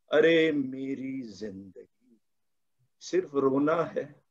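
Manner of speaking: a hint of a brooding hero, slow and low; slow brooding angry young man. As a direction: drag the words out like a sulking film hero. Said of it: "थोड़ा धीरे-धीरे बोलते हैं". (धीमी, उदास angry young man की आवाज़)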